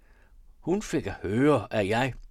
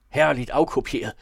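loud burst of praise; admiring exclamation; 3 semitones higher, 8 dB louder than usual. A narrator's reading. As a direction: exclaim it with raised voice. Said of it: "råbte han".

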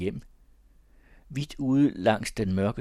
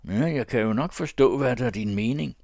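ordinary delivery, nothing marked

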